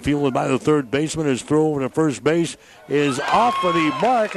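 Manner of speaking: excited baseball radio call; live play-by-play. Real, not acted. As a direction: call the action as it happens.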